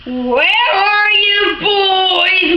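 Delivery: slow, drawn-out booming call. A narrator's reading. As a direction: call out like a booming father hunting the boys. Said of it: "my father's voice was booming".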